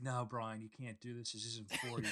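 soft, hushed Australian plea; Australian accent. Acted. Talking Brian out of the arena, Australian accent. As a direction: lightly slip into an Australian accent, quietly. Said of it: "you suddenly have an Australian accent".

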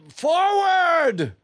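battle cry. Acted. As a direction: yell the charge.

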